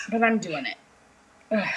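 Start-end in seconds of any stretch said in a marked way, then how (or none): 1.5-1.7 tired grunt of effort; strained groan. Real, not acted. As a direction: groan as you push down hard. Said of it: "Not sure I should be doing this".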